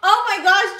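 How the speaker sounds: high, loud burst of delight; excited gasp of thanks. Real, not acted. Streamer sees George's eleven-month membership, excited exclamation.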